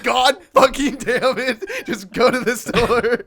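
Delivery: high, loud, slow laughing rant; hammed-up exasperated shout through laughter; mock frustrated yell. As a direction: yell it at him while cracking up. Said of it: "I'm like".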